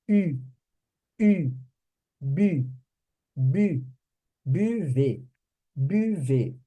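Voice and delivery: "U", "bu", "buvez" with tightly rounded lips; pouted French U. Shown as a demonstration. Teacher showing the pout for U, emphatic pronunciation drill. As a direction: build from isolated "U" up to "buvez", pouting. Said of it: "tem que fazer o biquinho".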